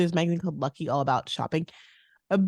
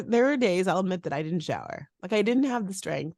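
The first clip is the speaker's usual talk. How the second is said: ordinary talk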